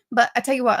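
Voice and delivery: ordinary delivery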